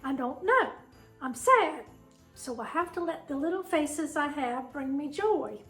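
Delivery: normal talking voice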